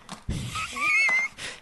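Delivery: big high shrieking laugh; huge burst of laughter. Real, not acted. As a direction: let out a wild howl of laughter. Belly laugh at prank.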